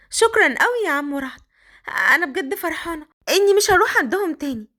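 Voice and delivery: put-on Qadr, high girlish voice. The girl complaining to Raad his clothes don't fit, high girlish voice. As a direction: speak up high like a shy young girl.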